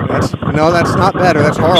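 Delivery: loud and fed up with the line. Real, not acted. Caller's line is awful.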